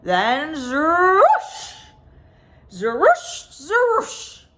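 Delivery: slow, drawn-out whooshing takeoff sounds; rocket whoosh. A narrator's reading. Spaceships taking off one after another, whooshing. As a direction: stretch each zerush like a ship blasting off.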